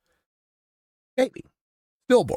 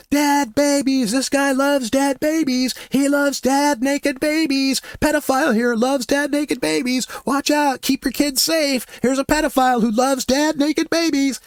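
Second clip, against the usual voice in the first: retold megaphone yelling; 12 semitones higher than usual. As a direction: call it out to the crowd like through a megaphone. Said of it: "I just yelled at him through the megaphone".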